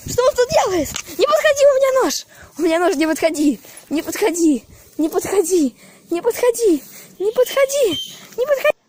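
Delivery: rising from tense to shrieking; panicked warning. Real, not acted. Threatening the monster with knife.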